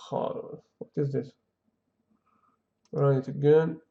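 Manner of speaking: usual speaking voice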